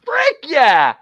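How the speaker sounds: high, loud burst of triumph; excited cheer. Retold or imitated. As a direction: cheer it out like the book just won. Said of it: "They've made me go".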